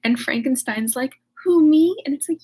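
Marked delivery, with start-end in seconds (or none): 1.4-2.0 high, louder playing-dumb squeak; mock innocent surprise. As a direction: pretend you have no idea. Frankenstein feigns innocence.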